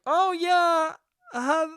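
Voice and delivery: high, frail old-lady imitation, halting; imitating a struggling old lady, words trailing; poor old woman. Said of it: "This poor old woman who looked".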